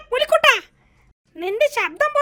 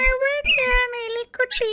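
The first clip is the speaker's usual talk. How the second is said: whimpering; 8 dB quieter, slower than usual. Acted. Lion cries like a mouse.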